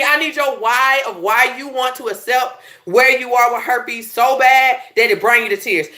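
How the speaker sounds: ordinary delivery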